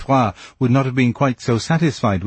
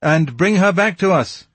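gaily called; 9 semitones higher than usual. A narrator's reading. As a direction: call it out gaily, pitched well up. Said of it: "cried the commissary gaily".

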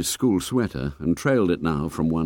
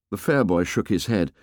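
plain reading voice

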